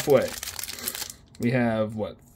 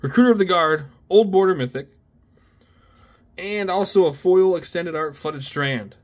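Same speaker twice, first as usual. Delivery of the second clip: thrilled listing; 5 semitones higher, 5 dB louder than usual. Real, not acted. Listing rare pulls, thrilled.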